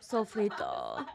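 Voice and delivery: Sofrito drawn out slowly; slow, careful attempt at Sofrito; practised pronunciation. Shown as a demonstration. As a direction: try out Sofrito, syllables stretched. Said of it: "how do you say it".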